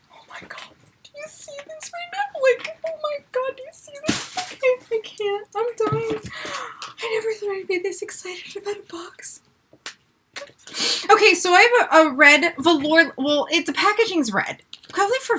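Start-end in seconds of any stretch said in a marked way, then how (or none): 0.1-9.4 breathless excited whisper; awed whisper. Real, not acted. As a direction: whisper in disbelief. Whispers in awe at item.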